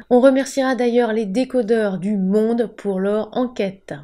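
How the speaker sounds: ordinary talk, nothing marked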